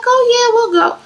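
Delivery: high, loud eager yes; excited. Retold or imitated. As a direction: jump at the invite. Eagerly accepting invitation.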